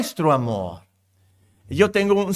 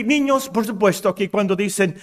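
ordinary talk

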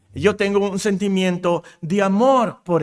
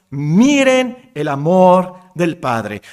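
slow, loud, fervent proclaiming; louder, drawn-out awe at the Father's love; passionate exclamation; 5 dB louder, slower than usual. Pretend. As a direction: savor every word with wonder. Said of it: "Juan sentía mucha pasión, mucha emoción".

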